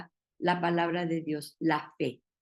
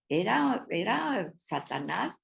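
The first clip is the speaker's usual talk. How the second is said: ordinary talk, nothing marked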